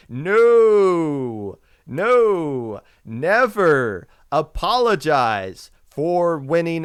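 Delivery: loud, slow, insistent; emphatic no. Real, not acted. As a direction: hammer the rule home slowly. Forceful rebuttal of the apology.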